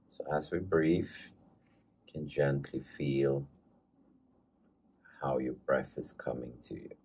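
calm meditation guide, leaving space between phrases; guided meditation. Acted. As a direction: ease listeners into following their breath, unhurried.

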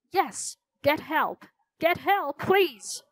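weak croak. A narrator's reading. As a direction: croak out a feeble plea for help. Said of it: "Mister Dewey croaked".

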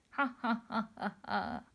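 mock evil laughter; villain laugh.

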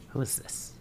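whisper. Real, not acted. Repeats question in whisper.

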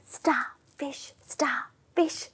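faint unvoiced whisper. Shown as a demonstration. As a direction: barely breathe the words. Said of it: "Super quiet little starfish".

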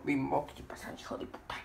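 low hissed whisper. Pretend. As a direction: whisper the insult. Whispered insult. (susurro bajo)

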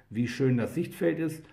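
ordinary delivery

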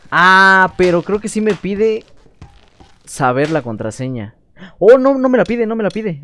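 high, loud happy surprise; excited relief. Real, not acted.

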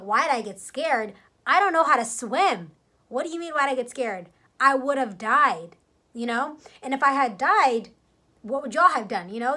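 reliving the fury at her friend; retold angry outburst. Yelling back at her friend.

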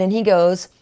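usual speaking voice

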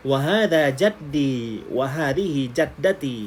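teacher reading the dialogue aloud for students to copy; careful Arabic dialogue read. Shown as a demonstration. Thai teacher models the Arabic lines clearly. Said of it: "ออกเสียงชัดๆขึ้นมาอีกนิดนึง".

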